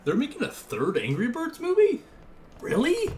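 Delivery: high, loud disbelief; astonished, squeaky-high questioning; incredulous surprise. Real, not acted. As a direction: pitch soars into a loud question. Reacting to news of movie.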